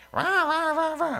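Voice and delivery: high, whiny growling rah sounds; mock tantrum grumble. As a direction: imitate a sulky child grumbling. Kid grumbling in the car.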